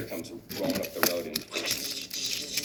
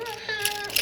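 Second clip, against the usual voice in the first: high cartoon scream; mock scream. Truck is thrown skyward, high mock scream. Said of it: "Mater's all".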